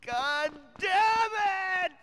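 loud frustrated curse; angry shout. Acted. Character curses loudly.

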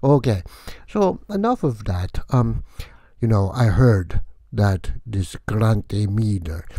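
loud, huffy vampire rant; vampire count voice. Acted. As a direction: complain grandly as the vampire. Count rants at Grant Miller.

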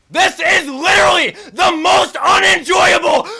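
high, loud, strained wail of frustration; near-crying rage. Real, not acted. Player wails at a boss that never stops swinging. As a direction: scream it on the edge of breaking. Boss won't stop attacking.

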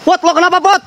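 very high, urgent questioning call; alarmed calling. Real, not acted. Calling to friend who screamed.

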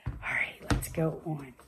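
soft low whisper; quiet whispered move-along. Acted. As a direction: drop to a hushed whisper. Hushed aside to kids.

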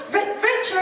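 high, startled calling out of a name; exasperated call. Retold or imitated. Costume girl chasing the undressing actor, high exasperated call. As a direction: call after him in mock dismay. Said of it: "costume girl picking bits up and going".

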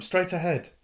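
high excited shout. A narrator's reading. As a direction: call it out urgently. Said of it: "shouted Harry".

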